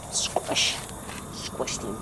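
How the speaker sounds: soft whispered squish. Real, not acted. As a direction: whisper it like plotting to squash the bug. About to squish a spider.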